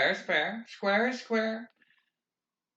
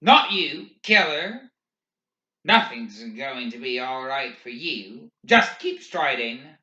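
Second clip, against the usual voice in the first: taunting; 9 dB louder than usual. A narrator's reading. McVries taunting Barkovich.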